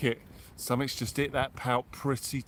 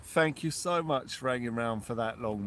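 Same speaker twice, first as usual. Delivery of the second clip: ordinary delivery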